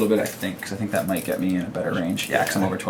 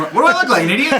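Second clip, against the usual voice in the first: laughing; 6 dB louder, faster than usual. Real, not acted. Joking reply while laughing.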